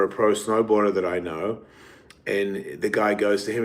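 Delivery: ordinary delivery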